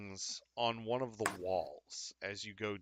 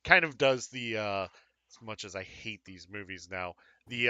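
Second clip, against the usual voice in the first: ordinary talk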